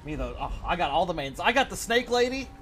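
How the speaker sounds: ordinary talk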